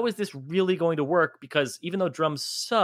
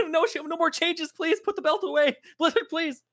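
much higher, squealing pleas broken by laughter; mock panicked players; 13 semitones higher than usual. Players begging Blizzard to stop, high and laughing. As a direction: beg for mercy while cracking up. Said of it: "they're gonna be like".

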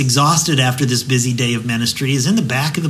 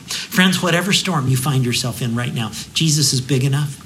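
normal talking voice

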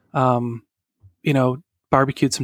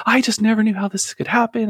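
high-pitched retelling of a distraught mom; imitated tearful disbelief; 9 semitones higher than usual. Mom back from crying in the bathroom, imitated distress. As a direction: plead bewilderment in a raised voice. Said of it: "she just starts bawling".

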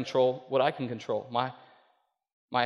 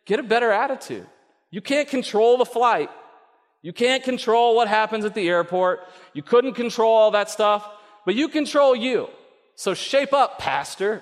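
a firm, raised God voice telling the pastor to shape up; God correcting; 6 semitones higher than usual. Retold or imitated. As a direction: lay down the law to him. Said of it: "He's like".

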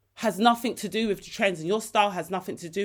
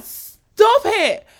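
high, loud mock-scolding squeal; emphatic, high-pitched gushing exclamation; overwhelmed delight; 13 semitones higher, 11 dB louder than usual. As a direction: squeal it, thrilled beyond belief. Acts out her overwhelmed reaction.

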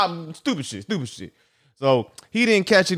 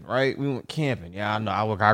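ordinary talk, nothing marked

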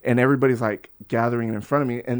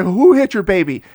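loud, raised-pitch retold question; alarmed demand; 8 semitones higher, 11 dB louder than usual. Man answering the crying lady, loud alarmed demand. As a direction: fire back an urgent shout.

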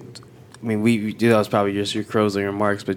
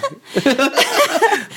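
laughing high at herself; a bright high laugh; 3 dB louder than usual. Real, not acted. Host laughing after saying she hates herself, high laugh.

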